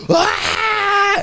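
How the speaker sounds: a sudden very high mock scream, Ah; retold scream. The storyteller acts out the French stranger's scream, a high mock yell. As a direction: burst out with a high yelped Ah, half laughing. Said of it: "screamed at the top of his lungs".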